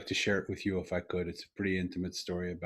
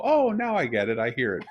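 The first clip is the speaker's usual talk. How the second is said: high, loud burst of understanding; sudden realization; 4 semitones higher, 7 dB louder than usual. Retold or imitated. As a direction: let the penny drop out loud, pitch jumping up. Acts out his moment of understanding.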